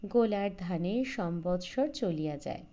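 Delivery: plain reading voice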